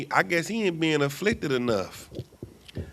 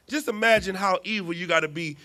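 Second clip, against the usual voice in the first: ordinary talk, nothing marked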